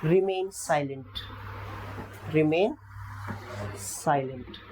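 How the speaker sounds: remain silent spoken very slowly, r not sounded; slow careful teaching. Shown as a demonstration. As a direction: spell it out slowly for learners. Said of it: "तो ये आर हमेशा".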